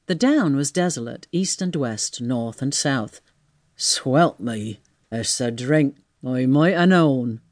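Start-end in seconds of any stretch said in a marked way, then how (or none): 3.8-7.4 low-pitched tramp muttering to himself; rueful tramp. Acted. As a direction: blame yourself in a rustic voice. Tramp blames the drink.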